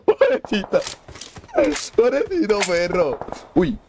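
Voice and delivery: squeaky high "poor thing"; high-pitched pity. Real, not acted. Gamer pitying a victim, high-pitched cooing.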